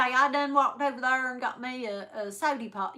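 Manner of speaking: imitating a country Southern friend. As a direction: lightly mimic a sweet old Southern country boy. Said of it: "that was how he spoke".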